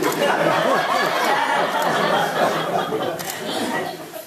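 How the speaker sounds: big breathy laugh at her own joke; laughing hard; loud laugh. Real, not acted. Reader laughs at bacon joke.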